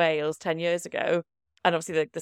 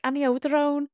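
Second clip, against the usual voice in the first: amiodarone rolled off the tongue in a higher Scouse lilt; imitating a Scouse colleague saying Amiodarone; 7 semitones higher than usual. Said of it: "used to roll off the tongue".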